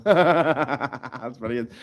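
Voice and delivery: ordinary talk, nothing marked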